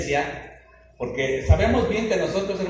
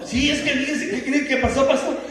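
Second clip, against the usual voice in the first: mock-anxious woman's voice, pitched up; hammed-up panic over a lost phone; desperate churchgoer; 7 semitones higher than usual. Churchgoer begs the pastor to open the church, mock desperate. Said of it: "bien desesperada".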